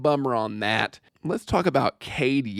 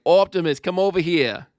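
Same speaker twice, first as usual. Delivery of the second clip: put-on Boston accent; 5 semitones higher than usual. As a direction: ham up a Boston guy heading to Fenway. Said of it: "in the Boston accent".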